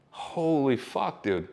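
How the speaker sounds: slow, drawn-out amazement; mock awe at the competition; stunned awe. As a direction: let the numbers sink in.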